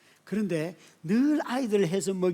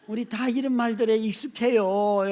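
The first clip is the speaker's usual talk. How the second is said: normal talking voice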